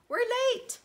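high, loud exclamation of being late; urgent call. Acted. Mouse hurrying her friend to the party, urgent call. Character urges Pip to hurry.

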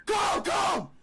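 high, hammed-up screaming plea; fake panic. Prank caller begging officer to come, fake panicked screaming. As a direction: shout for help in mock desperation.

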